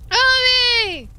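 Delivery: high, loud desperate yell; shouting a name. Acted. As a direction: scream her name into the flames. Calling out for friend in fire.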